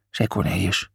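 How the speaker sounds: ordinary delivery, nothing marked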